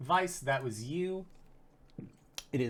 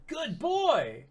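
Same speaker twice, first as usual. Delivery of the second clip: excited; 12 semitones higher than usual. Real, not acted. Praising a big roll.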